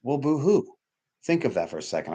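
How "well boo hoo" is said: higher, sarcastic "boo-hoo"; mocking boo-hoo. Real, not acted. Speaker mocking people who feel entitled to grief, sarcastic. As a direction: sneer at their self-pity.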